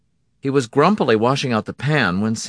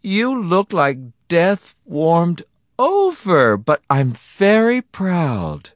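high, slow wife voice, drawn out; proud, sympathetic wife; 10 semitones higher, 4 dB louder, much slower than usual. Acted. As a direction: praise him high and slow, as his wife. Wife comforts and praises husband.

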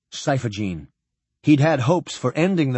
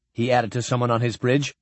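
ordinary delivery

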